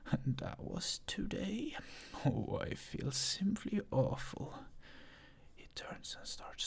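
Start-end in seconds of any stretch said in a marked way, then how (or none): 0.0-4.7 understated mock surprise. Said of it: "faking astonishment".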